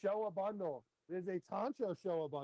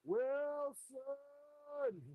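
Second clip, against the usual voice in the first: calling out; 7 semitones higher than usual. Real, not acted. Calling a bidder by name.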